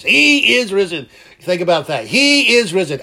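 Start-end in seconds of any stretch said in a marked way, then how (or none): 0.0-1.1 loud, high and slow proclamation; booming Easter cry; outside voice. Shown as a demonstration. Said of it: "Okay, outside voice". 2.1-3.0 loud, high proclamation; ringing Easter cry; outside voice. Shown as a demonstration. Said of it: "Outside voice".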